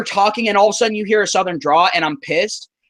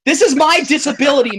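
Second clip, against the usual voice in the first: high, indignant comeback; hammed-up outrage, pitched well up; mock angry retort; 7 semitones higher than usual. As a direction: fire back at imagined accusers in exaggerated protest. Acted angry retort to the accusers.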